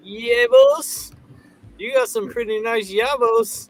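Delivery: high put-on voice, hammed up; teasing girl. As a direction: tease playfully in a high mock-girl voice. Quotes girl teasing about yabos.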